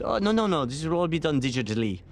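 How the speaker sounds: hammed-up French accent on a dismissive quote; terrible French accent. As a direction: imitate a French colleague with a bad accent. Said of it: "terrible French accent".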